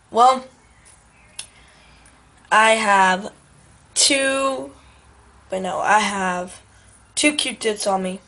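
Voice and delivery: ordinary delivery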